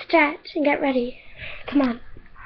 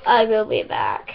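low, softer mock-ominous promise; grand exit line; 6 semitones lower, 5 dB quieter than usual. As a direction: lower it for a dramatic exit. Judge character exits grandly.

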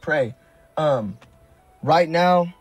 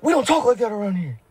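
imitating Steve; 6 semitones higher than usual. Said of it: "last time he was like".

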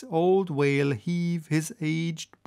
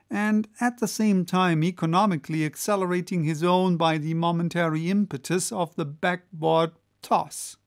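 ordinary delivery

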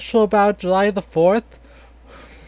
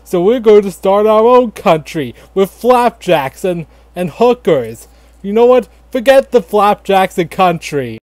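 mock angry rant; 2 semitones higher, 4 dB louder than usual. Colonist telling off King George, loud mock rant. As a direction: tell off King George at full volume.

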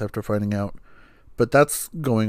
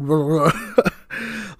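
amused laugh; 6 semitones higher than usual. Real, not acted. Laughing at dad's taunt.

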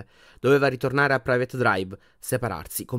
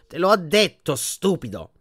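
higher, mock-sweet put-down; sweetly condescending; 6 semitones higher, 7 dB louder, slower than usual. A narrator's reading. Portrait answering Harry, sweetly condescending. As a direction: start raised, then settle smugly. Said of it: "rispose dolcemente Phineas Nigellus".

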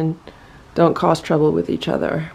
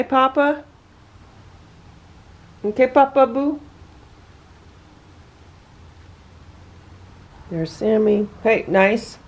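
sing-song pet talk; 4 semitones higher than usual. Real, not acted. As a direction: sweet-talk the big cat into being gentle. Cooing at the cat.

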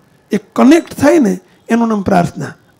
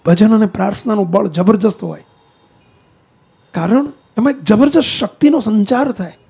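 normal talking voice

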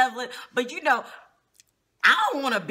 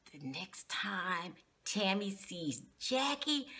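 whisper; 4 semitones lower, 11 dB quieter, slower than usual. Real, not acted. Gossip aside to viewers.

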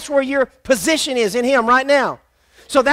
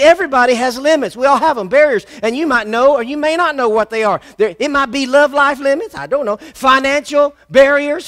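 normal talking voice

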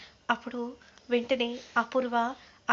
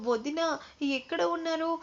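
raised pitch, calling for sister-in-law; mildly urgent, higher-pitched call; 5 semitones higher, 4 dB louder than usual. A narrator's reading. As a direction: call out to someone elsewhere in the house. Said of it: "అనే విధంగా అంటూ మీరా పిలుస్తూ ఉంటే".

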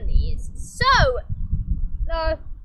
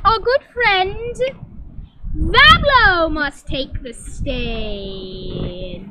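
loud, drawn-out host reveal; slow hammed-up game-show reveal; slow grand announcement; 7 dB louder, slower than usual. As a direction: stretch every word for drama.

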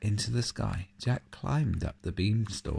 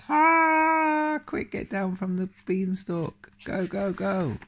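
high, loud panicked cheering; frantic urging; 12 semitones higher, 5 dB louder than usual. Acted. Cheering Jack to escape the giant, high and frantic. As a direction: yell him down the beanstalk.

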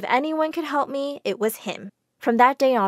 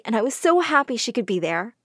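ordinary delivery, nothing marked

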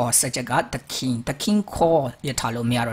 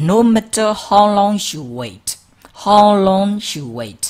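slow, louder and higher; emphatic model phrase; 7 semitones higher, 7 dB louder, much slower than usual. Shown as a demonstration. Teacher drills the phrase, slow, loud and high. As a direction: stretch each word out for learners. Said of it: "model phrase repeated".

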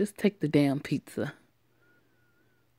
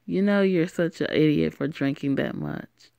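ordinary talk, nothing marked

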